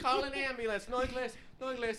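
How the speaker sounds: mock plea.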